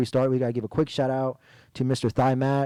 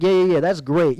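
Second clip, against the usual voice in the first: sarcastic; 4 semitones higher, 6 dB louder than usual. Retold or imitated. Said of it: "and I'm like".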